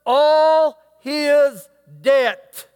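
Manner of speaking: all his debt stretched very slow and loud; preacher's slow emphasis; drawn-out stress. Acted. Preacher stretching key words.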